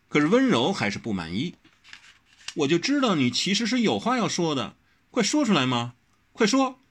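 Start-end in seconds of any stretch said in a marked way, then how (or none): 2.6-6.8 insistent urging. Acted. Wen Rou demanding Wang Xiaoshi speak. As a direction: nag him to tell.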